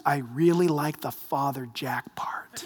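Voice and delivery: hushed teasing whisper. Retold or imitated. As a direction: breathe it softly, slow and secret. Whispered joke reply to son-in-law.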